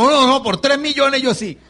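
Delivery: high, loud eager buyer; mock eagerness. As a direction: jump in loudly, imitating someone desperate to buy. Acting eager buyer raising hand. (comprador ansioso, imitado a gritos)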